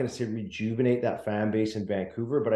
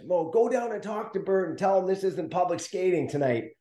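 higher put-on coach voice barking orders; imitating coach yelling; 8 semitones higher than usual. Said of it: "yell at me on the bench".

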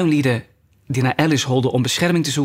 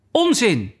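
high, determined retort; loud and resolute; 8 semitones higher than usual. A narrator's reading. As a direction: cut in firmly, pitched well up. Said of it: "zei Alice heel luid en vastbesloten". (luid en vastbesloten)

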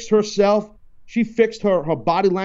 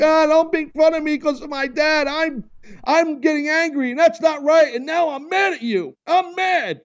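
high whiny crybaby voice, much higher; mock crybaby; 10 semitones higher than usual. Host mocking wrestler's storyline as whiny crybaby. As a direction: whine it like a sulking kid. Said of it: "He's gonna come off like".

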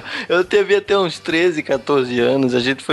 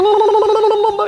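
gobbling turkey voice; 4 dB louder, slower than usual. Retold or imitated. Imitating turkey chasing him.

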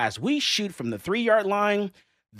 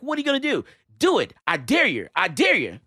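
imitating a high, goading taunt; retold taunting dare; 5 semitones higher, 4 dB louder than usual. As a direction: egg him on, mocking and daring. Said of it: "and they're like".

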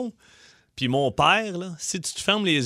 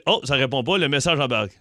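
ordinary talk, nothing marked

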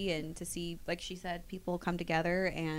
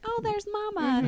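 put-on high-pitched child spotting a parent; imitated little kid; 14 semitones higher than usual. Mom imitating her little kids watching her trailer, high-pitched. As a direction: pipe up like a small child, much higher. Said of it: "they'll watch my trailer and like".

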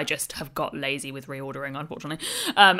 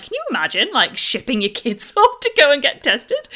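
high, amazed disbelief with a laugh; incredulous; 8 semitones higher, 7 dB louder than usual. Retold or imitated. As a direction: voice rising in disbelief. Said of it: "and I'm like".

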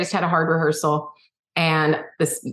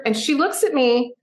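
ordinary delivery

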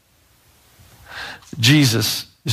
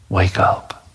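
tender whisper; 5 semitones lower than usual. Acted. Jesus to the dead girl, tender whisper. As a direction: whisper it like waking a sleeping child.

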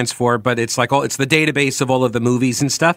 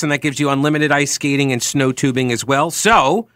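ordinary talk, nothing marked

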